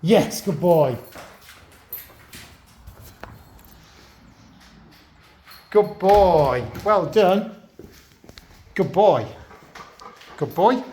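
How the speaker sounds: reward him with happy praise; dog praise. Real, not acted.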